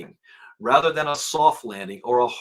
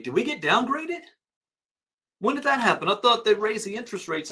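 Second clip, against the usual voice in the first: high, startled asking; surprised questions; 8 semitones higher than usual. Real, not acted. Host asking viewers about the downgrade, high surprise. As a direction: caught off guard by the news.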